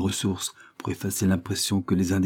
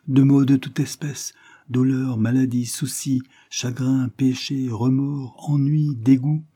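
higher, insistent litany; bitter governess listing woes; 6 semitones higher, 3 dB louder, slower than usual. Acted. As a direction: count off each misery on a raised pitch.

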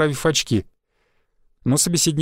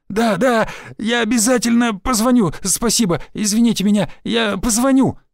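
higher, cowed and pleading; mumbling; 6 semitones higher than usual. A narrator's reading. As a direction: mumble it out in fear. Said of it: "мямлил Шульман". (мямлит испуганно, выше)

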